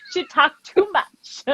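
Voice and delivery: laughing imitation. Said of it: "nail lady said".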